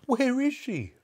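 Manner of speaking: high, panicked question; crying out. A narrator's reading. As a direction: cry out searching for her. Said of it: "cried Missus Cranky".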